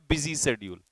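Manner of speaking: ordinary delivery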